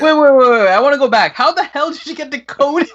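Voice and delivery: high, loud incredulous interruption; amused disbelief. Real, not acted. Co-host going back to the Conehead mistake, loud disbelief. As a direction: cut in high and insistent, baffled. Halts talk, baffled by the name.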